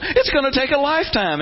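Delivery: high mock groan; weary whine. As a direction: moan at how long it takes. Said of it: "We're looking at ourselves going".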